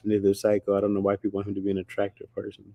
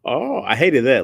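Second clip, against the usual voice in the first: ordinary delivery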